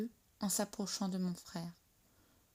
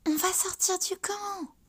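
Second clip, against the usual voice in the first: higher, eager and bright with thrill; bursting with the secret plan; excited; 10 semitones higher, 4 dB louder than usual. A narrator's reading. Young cat telling her friend about sneaking out, excited. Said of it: "chuchote Petite Verte avec excitation".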